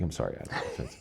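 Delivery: a giggling, teasing sorry; laughing apology. Real, not acted. Joking apology to absent guest.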